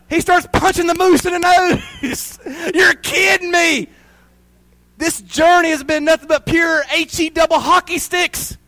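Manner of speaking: a half-crying mock rant; Clark losing it. Clark at closed Wally World, hammed-up crying rant.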